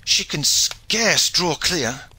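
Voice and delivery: high, slow, stretched-out cry; anxious cry. A narrator's reading. Shipmaster watches the breakers near, crying out. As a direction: draw the words out in alarm. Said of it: "cried Hawtayne".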